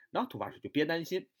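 ordinary talk

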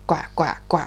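low croaking ribbit; frog croak. Acted. The frog greets the mole with a low croak.

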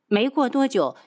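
ordinary delivery

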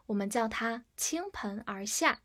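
ordinary talk

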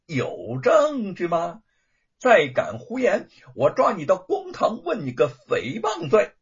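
high, mocking sneer rising to a loud threat; bully him, then threaten; taunting young master. A narrator's reading. Spoiled young master taunting Wu, sneering threat.

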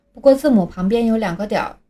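usual speaking voice

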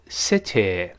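"city" wrongly ending in a lax "eh"; mispronounced final vowel. Shown as a demonstration. Teacher warning against a misreading, "city" ending in eh. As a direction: say "city" as "seh-teh", without the ee ending. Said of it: "不要读city".

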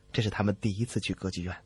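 ordinary talk, nothing marked

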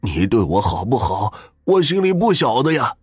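husband refusing to outlive his wife; crying out, slowing at the end. A narrator's reading. Weeping husband answers wife.

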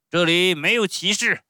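loud, high-pitched ogre bark; stern showman. Acted. Showman refuses flattering title, stern shout. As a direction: shout the plea down.

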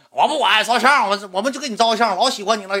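high, loud fan clamour; crowd shouting. Retold or imitated. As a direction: imitate overexcited fans yelling. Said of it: "就开始喊上了".

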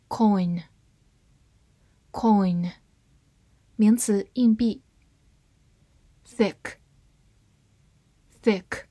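normal talking voice

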